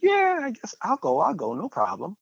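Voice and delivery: high, lightly voiced wife agreeing; a hint of wife's casual yes; imitating wife. Wife answering her aunt's church invite, lightly imitated. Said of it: "my wife's like".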